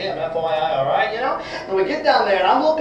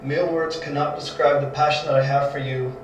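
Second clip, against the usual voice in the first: lower, soft and sincere; heartfelt confession; 7 semitones lower, 6 dB quieter than usual. Acted. Peter confessing love to Jesus, lower and sincere. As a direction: pour out his love quietly.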